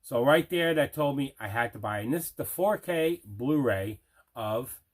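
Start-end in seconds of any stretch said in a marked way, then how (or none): none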